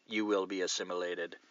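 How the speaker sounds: low, quiet monotone; lightly voiced robotic drone; Borg catchphrase. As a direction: toss it off as a hushed, level aside. Borg catchphrase joke on surname.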